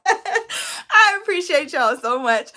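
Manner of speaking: high, loud, tearful laughing thanks; choked up with gratitude, half laughing; laughing through tears. Real, not acted. Thanking her live viewers, laughing through real tears. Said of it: "And I hate crying".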